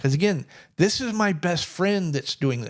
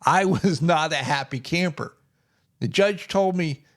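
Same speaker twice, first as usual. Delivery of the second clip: ordinary talk, nothing marked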